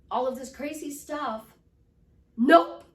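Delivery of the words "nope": "nope" very loud, high and rising; pattern-interrupt NOPE. Shown as a demonstration. Coach breaking off crazy thoughts, forceful "nope". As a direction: cut in hard with a lifted "nope". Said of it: "I used a pattern interrupt".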